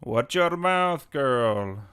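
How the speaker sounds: slow, loud villain voice, falling at the end; forceful put-on game villain; Barkov. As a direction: draw out a stern warning in character. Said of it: "Can you do the Barkov voice?".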